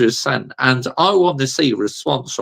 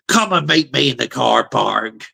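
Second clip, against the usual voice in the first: put-on Bristolian accent. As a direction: put on a Bristol and Somerset accent for the rant line. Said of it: "that sounded real Bristolian and Somerset then".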